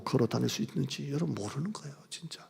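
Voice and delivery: whispered warning. Acted. Pastor reminding listeners of mortality in a whisper. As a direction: sink to a whisper.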